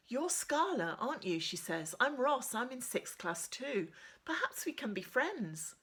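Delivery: put-on high, eager girl's voice; eager young girl. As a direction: pitch her up like a keen new friend. Geeky girl Ross introduces herself.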